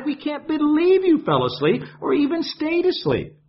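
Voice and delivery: high, frantic pleading to wake him; panicked disciples nagging a sleeper; frantic scolding. Acted. Disciples berating the sleeping Christ, high and frantic. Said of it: "we could add a few other things".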